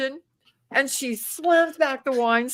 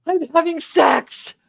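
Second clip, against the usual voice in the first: blurted confession; 6 semitones higher, 4 dB louder than usual. Retold or imitated. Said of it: "and I went".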